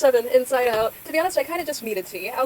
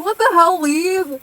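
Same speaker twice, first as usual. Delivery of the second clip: loud indignant yell; outraged shout; 6 semitones higher, 11 dB louder, much slower than usual. Real, not acted. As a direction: shout it in disbelief.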